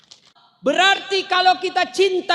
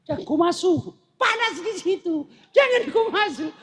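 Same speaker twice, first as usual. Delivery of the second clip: hammed-up yell, climbing higher to the end; retold warning yell, a little higher each time; mock shout; 4 semitones higher than usual. Chinese man yells a warning at the lift, mock shouting. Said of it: "dia teriak".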